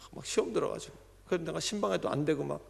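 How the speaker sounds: ordinary talk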